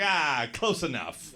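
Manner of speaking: slow, offhand brush-off; dismissive. Retold or imitated. Some guy corrected on his nationality, dismissive. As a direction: shrug it off as near enough. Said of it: "He's like".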